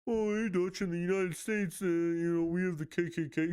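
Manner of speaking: high, nasal-free whiny mock voice; mocking critic. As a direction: play a smug commenter, high.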